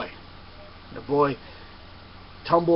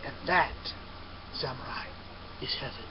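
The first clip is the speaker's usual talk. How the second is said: much softer, gentle and final; soft revelation; 9 dB quieter than usual. Acted. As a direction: let it land almost in a hush. Monk quietly reveals heaven.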